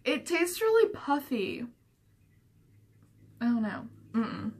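talking between bites of snack; chewing while talking. Real, not acted. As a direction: mouth partly full, grimacing. Seen 0: Bites rind at -9, grimaces and chews while talking, holds piece.

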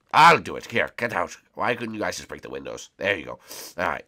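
ordinary talk, nothing marked

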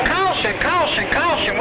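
high, urgent radio call; urgent caution call. Real, not acted. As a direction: sound the alarm on the radio. Race official calling a caution.

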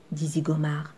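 plain reading voice

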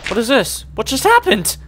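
high baffled question; high confused what just happened; surprised. Real, not acted. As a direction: sound completely thrown. Confused after teleport.

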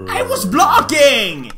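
raging gamer. Real, not acted. Outraged at losing fight.

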